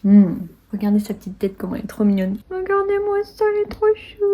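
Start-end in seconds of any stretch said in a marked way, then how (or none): 2.5-4.2 cooing. Real, not acted. Gushing over a cute treat.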